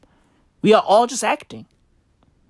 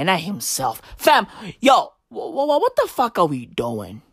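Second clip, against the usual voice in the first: ordinary talk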